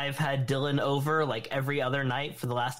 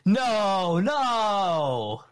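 shocked no; 5 semitones higher, 4 dB louder than usual. Real, not acted. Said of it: "reacting in disbelief".

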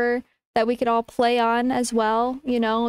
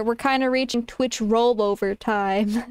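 ordinary delivery

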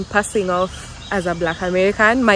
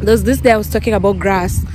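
usual speaking voice

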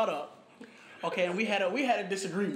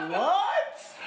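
high, laughing disbelief; a squeaked, stunned what; incredulous laughing what; shocked what; 12 semitones higher, 5 dB louder than usual. Retold or imitated. Reacting in disbelief to slur.

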